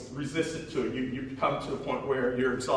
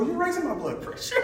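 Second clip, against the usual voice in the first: much higher, laughing mock scold; imitating mom; 14 semitones higher than usual. Son imitating his mom's high, laughing complaint. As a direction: mimic an exasperated mom, half laughing. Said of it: "she'll go".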